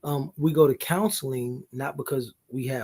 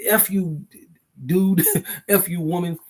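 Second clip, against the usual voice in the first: lightly hammed-up, raised "F you"; mild mock anger; 5 semitones higher than usual. Husband acting out going to bed mad, mildly mocking. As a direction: playfully snap at the spouse. Redoing the insult, toned down.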